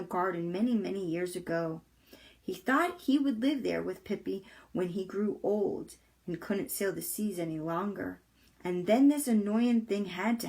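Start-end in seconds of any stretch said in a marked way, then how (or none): none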